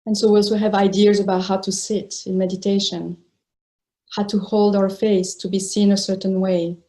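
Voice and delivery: sparse phrases parted by long silences; guided meditation. Real, not acted. Teacher inviting meditators to release the body, spacious guided meditation. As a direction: let each phrase rest before the next.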